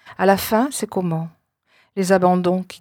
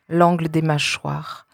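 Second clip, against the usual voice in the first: ordinary talk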